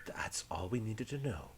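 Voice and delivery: soft, whispery, measured interrogation; hushed, courteous threat; polite menacing pirate officer. Acted. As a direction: coax the captive with quiet menace.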